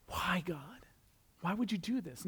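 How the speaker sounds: breathy whisper, pleading why; whispered lament. Retold or imitated. Pastor retelling his complaint to God, whispered lament. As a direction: whisper it like a wounded question to God.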